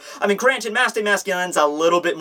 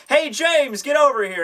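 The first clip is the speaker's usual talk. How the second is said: higher-pitched mock shout across to James; calling out; 9 semitones higher than usual. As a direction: call over to a sidekick, pitched up. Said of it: "he shouts over to James".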